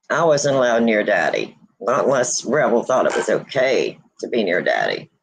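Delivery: usual speaking voice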